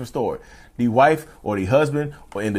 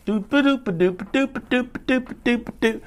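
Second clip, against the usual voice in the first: carefree doo-doo hum; 12 semitones higher, 3 dB quieter than usual. Retold or imitated. Carefree humming after mask on.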